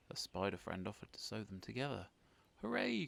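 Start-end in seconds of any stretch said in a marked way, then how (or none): 2.6-3.1 loud, high hooray; cheering cry. Acted. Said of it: "cried the Scarecrows".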